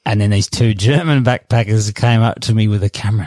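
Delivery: usual speaking voice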